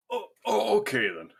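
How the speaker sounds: high startled oh, then settling; dwarf taken aback by the answer; flustered oh. Acted. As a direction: caught off guard, recover quickly.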